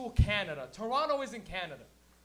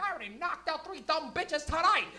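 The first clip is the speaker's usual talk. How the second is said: put-on tough old lady; 7 semitones higher than usual. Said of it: "She's like".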